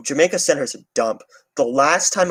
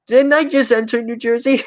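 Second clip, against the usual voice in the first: mock confusion; 9 semitones higher than usual. Crossing the Goethals Bridge, playing mock confused and higher. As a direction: act baffled, pitch it up. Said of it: "And I'm like".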